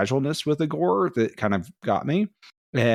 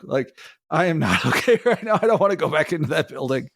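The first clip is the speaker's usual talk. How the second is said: half-laughing retold panic; laughing through it; 4 semitones higher than usual. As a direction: laugh as you admit you're not okay. Retelling being shaken, laughing.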